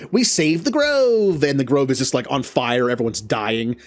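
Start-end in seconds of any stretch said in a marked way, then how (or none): 0.1-1.4 high, slow triumphant cheer; mock a clueless hero's proud cry; hammed-up cheer amid ruin; oblivious hero. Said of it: "where it's just like".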